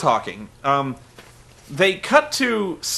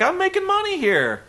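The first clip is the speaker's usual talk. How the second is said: squeaky high protest; lightly mocking the Pope; high mock whine; 10 semitones higher than usual. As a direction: Pope refusing to leave, high whiny. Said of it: "Pope's like".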